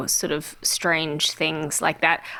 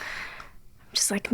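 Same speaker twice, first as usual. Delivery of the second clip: usual speaking voice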